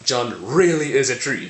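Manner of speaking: "really" hit high and loud; emphatic insistence. Shown as a demonstration. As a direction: insist firmly, punching the word "really". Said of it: "That can mean".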